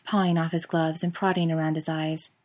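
plain reading voice

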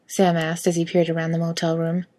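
ordinary delivery, nothing marked

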